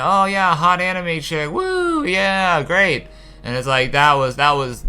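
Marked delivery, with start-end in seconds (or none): 0.0-3.1 sarcastic mock cheer.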